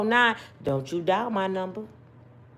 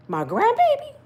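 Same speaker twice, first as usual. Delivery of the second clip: outraged disbelief; 14 semitones higher than usual. Real, not acted. Outraged about grandbaby.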